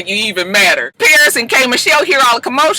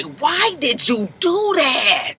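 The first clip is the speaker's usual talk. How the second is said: slow disbelieving; 4 semitones higher, 5 dB quieter, much slower than usual. Retold or imitated.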